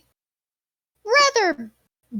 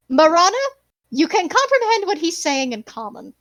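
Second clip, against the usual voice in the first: ordinary talk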